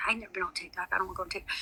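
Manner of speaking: soft, whispered mock refusal; whispered retort. Telling viewers how she turned Ginger down, whispered. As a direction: hush it like a half-joking refusal.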